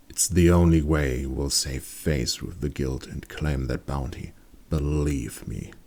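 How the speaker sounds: low conspiratorial menace, slowing at the end. A narrator's reading. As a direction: lean in low and threatening. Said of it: "in a quieter, dangerous voice".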